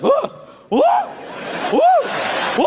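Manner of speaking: short, high frightened whoops; bitchy noises. Retold or imitated. Man on plane during turbulence, imitated bitchy whooping. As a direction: imitate a panicky little yelp, spaced out. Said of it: "these bitchy noises".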